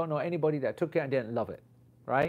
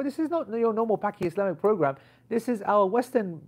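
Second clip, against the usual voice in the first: high, louder arguing; indignant protest; 9 semitones higher, 4 dB louder than usual. Retold or imitated. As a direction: re-enact a heated objection, pitched well up. Retold argument with the organizer.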